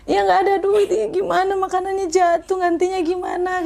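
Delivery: high-pitched tearful lament, retold not sobbed; retold crying. Telling the host how she cried over the crushed order, high tearful plea. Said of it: "Kayak gitu".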